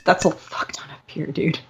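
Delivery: awed whisper of disbelief. Real, not acted. Friend hears how much beer, whispered in awe. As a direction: breathe it out in a hushed whisper.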